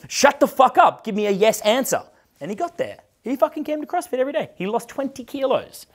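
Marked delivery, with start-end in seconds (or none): 0.1-2.0 high, forceful shut-down; retold scream. Coach silencing a client's excuses, high retold yell. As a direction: re-enact cutting him off with a yell. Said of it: "I fucking screamed at this guy".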